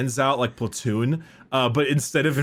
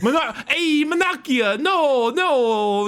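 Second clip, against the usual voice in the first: much higher, laughing mock Italian rant; mock Italian Lorgar; 11 semitones higher than usual. Lorgar cursing at Guilliman in a high mock Italian voice. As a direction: ham up a furious Italian Lorgar.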